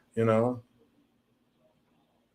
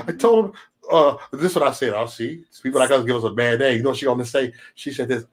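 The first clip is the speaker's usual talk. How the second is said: ordinary talk, nothing marked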